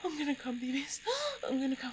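high voice on the edge of climax; urgent arousal. Acted. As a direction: build urgently toward the peak. Intimate performance nearing its climax.

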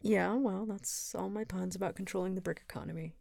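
normal talking voice

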